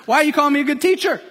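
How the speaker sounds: high, fast, pointed challenge; a quick, high, pointed challenge; pointed question. Retold or imitated. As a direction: fire the question back quickly. Jesus quoted pointedly.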